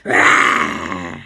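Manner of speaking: low, loud playful lion roar; lion boy roaring at people in fun; mock lion roar. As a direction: pounce with a pretend scary roar. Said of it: "being a super scary lion and sneaking up on stuff and going".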